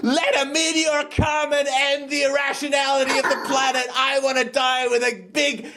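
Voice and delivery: high, raging shouts; furious shouting. Acted. Host voicing a rational mind raging at culture, high shouts. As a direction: scream it like a mind declaring war on culture. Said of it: "the next soundscape".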